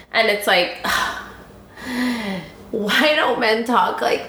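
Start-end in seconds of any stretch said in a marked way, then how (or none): 1.8-2.4 dreamy sigh. Real, not acted. Swooning over the quote.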